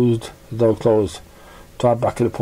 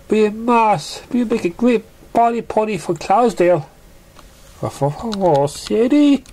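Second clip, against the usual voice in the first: high pony voice, upbeat; a filly cheering up her friend; high bouncy cartoon pony; cheerful young pony; 9 semitones higher than usual.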